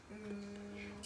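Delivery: ordinary talk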